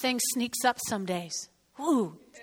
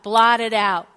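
ordinary talk, nothing marked

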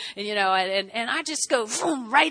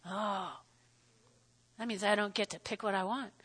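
deflated aside; 5 semitones lower, 13 dB quieter than usual. Retold or imitated. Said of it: "I'm like".

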